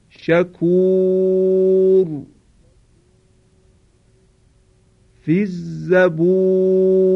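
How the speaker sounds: slow Quranic chant, النار فی الغار and شکور فی الزبور with ر dark and emphatic; repeated tajweed model recitation for the class; heavy R recited. Shown as a demonstration.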